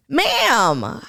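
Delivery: usual speaking voice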